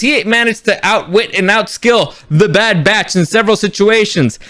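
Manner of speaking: ordinary talk, nothing marked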